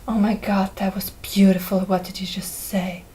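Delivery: hushed, breathless amazement; awed whisper. Retold or imitated. Nick reacting to Monroe's proverb, in an awed whisper. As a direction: whisper it like you've heard poetry.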